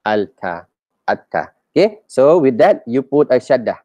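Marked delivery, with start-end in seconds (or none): 0.0-0.7 Alta with the l sounded; Alta, lam kept before t; unassimilated Alta; wrong al-ta. Shown as a demonstration. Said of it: "easier to say atta rather than alta". 1.1-1.5 atta with a doubled t; atta, no l heard; assimilated atta. Shown as a demonstration. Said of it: "easier to say atta rather than alta".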